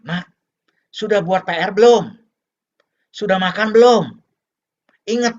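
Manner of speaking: loud, high-pitched parental nagging. Acted. Parent phoning child about homework and meals, nagging. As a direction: drill it into the child, loud and insistent. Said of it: "saat nelepon anaknya, langsung ditanya".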